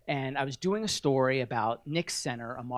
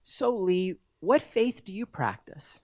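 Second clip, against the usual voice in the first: higher-pitched imitation of curious parents; retold dinner-table question, pitched up; inquiring parents; 6 semitones higher, slower than usual. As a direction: lightly voice a parent questioning a guest. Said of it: "the parents turned to me and they say".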